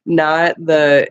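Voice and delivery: ordinary delivery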